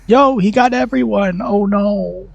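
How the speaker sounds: high, loud cry of dismay; real alarm as the whole team falls; dismayed shout. Real, not acted. Streamer watches the killer down everyone and shouts in dismay.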